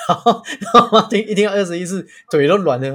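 giggling as you tell it; laughing while telling the mom's reaction. Real, not acted.

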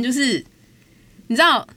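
ordinary talk, nothing marked

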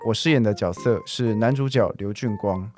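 ordinary delivery

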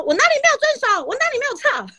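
high indignant talking back; defiant retort. Retold or imitated. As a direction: refuse to lose face, fire it back. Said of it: "输人不输阵".

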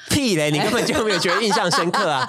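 high, laughing teasing protest; laughing disbelief. Real, not acted. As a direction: laugh and call out the bluff. Host laughs, calls praise fake.

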